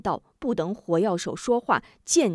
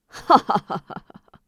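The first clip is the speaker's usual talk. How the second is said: loud mocking laugh; 6 dB louder than usual. Villains laugh at prisoners.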